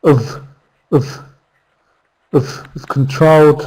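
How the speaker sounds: "of" as a tiny weak uhv, no I sound; reduced I've. Shown as a demonstration. Teacher demonstrating reduction, "of" short with no I. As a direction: squeeze I've to "of" before "controlled". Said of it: "we might not even use".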